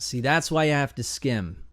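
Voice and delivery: usual speaking voice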